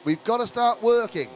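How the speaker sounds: high, loud race call; excited commentary. Real, not acted. Lively play-by-play call.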